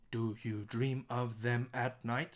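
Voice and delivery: slow, evenly separated single syllables; word by word. Shown as a demonstration. Teacher showing one-syllable words, slow and even. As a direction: count it out one word at a time. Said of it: "is one syllable words".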